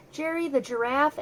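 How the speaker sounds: usual speaking voice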